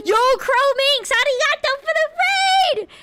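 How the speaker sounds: high excited shout of thanks; cute anime streamer. Real, not acted. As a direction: squeal a welcome to the raiders. Greeting raiders excitedly.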